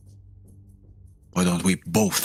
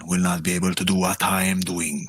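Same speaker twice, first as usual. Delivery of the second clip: usual speaking voice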